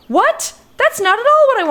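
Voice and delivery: outraged disbelief at a dialogue choice; indignant surprise. Real, not acted. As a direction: protest that the game misquoted you. Reacting to game dialogue choice.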